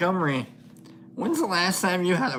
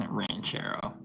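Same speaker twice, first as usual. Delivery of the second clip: breathy laugh; 7 semitones lower, 12 dB quieter than usual. Real, not acted. Laughing at a viewer's guess.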